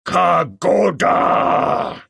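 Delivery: very slow, loud cry of yielding; beaten ape crying surrender. Acted. The defeated king ape yielding to the ape man, slow loud cry. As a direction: drag out the word as you give in. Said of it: "he cried".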